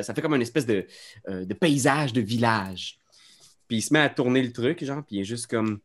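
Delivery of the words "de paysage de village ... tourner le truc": ordinary delivery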